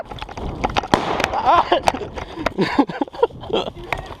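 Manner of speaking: breathy laugh. Real, not acted. After getting hit.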